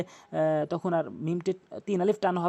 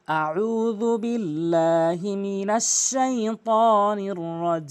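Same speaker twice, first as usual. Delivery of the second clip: slow melodic tajweed chant, long held vowels; Quranic recitation; much slower than usual. Shown as a demonstration. Teacher begins the lesson with measured chanted recitation. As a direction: recite solemnly, stretching every vowel. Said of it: "তো শুরু করছি, একটু মনোযোগ দিবেন।".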